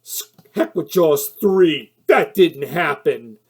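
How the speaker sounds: loud, slow, scoffing dismissal; mock exasperated executive waving it away; dismissive studio exec. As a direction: brush it off like a fed-up producer. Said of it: "there's a studio saying".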